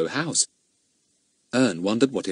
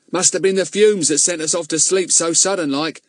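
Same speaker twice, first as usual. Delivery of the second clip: doleful; 9 semitones higher, 4 dB louder than usual. Acted. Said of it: "said his companion dolefully".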